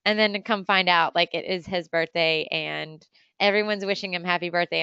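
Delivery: ordinary talk, nothing marked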